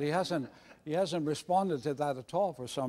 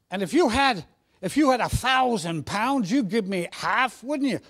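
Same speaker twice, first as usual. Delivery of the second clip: put-on higher, louder Cockney boy; Cockney accent; 4 semitones higher, 4 dB louder than usual. Cockney boy testing his pal's devotion, high Cockney. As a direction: press the question in perky Cockney. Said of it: "Should I put on a Cockney accent".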